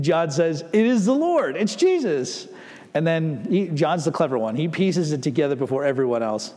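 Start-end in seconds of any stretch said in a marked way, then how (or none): 0.7-2.5 high-pitched acted exclamation; excited recognition. John telling the others it is Jesus, excited exclamation. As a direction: announce a thrilling discovery, voice lifted.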